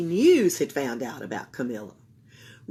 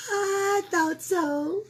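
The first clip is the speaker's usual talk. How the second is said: high, slow knowing smile; old woman pleased; smiling; 13 semitones higher, 3 dB louder, much slower than usual. A narrator's reading. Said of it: "said the woman with a smile".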